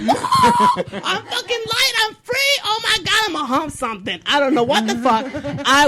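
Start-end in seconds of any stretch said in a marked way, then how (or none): 0.0-4.2 high, squealing giddy dog, laughing through it. Retold or imitated. Storyteller voicing the shaved dog running round the house, high and giddy. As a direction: play the dog as if he's just been set free.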